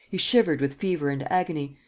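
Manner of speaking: usual speaking voice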